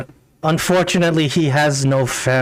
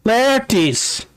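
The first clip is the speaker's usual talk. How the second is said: higher, calling out a name from far away; woman calling from afar; 9 semitones higher than usual. Acted. An old woman searches for the lost dwarf, calling from afar. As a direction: call across the distance for him. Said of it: "from a distance, uh, there is a woman's voice".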